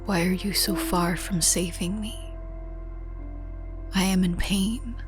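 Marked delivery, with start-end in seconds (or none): none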